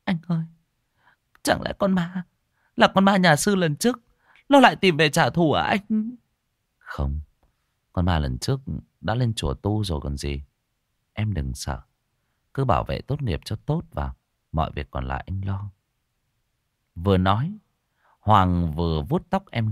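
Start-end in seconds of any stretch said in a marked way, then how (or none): 0.0-6.1 put-on high, anxious young voice; frightened girl. Kim fearful, asking brother. 6.8-15.7 lower, soft comforting voice; reassuring brother. Acted. Brother calming sister.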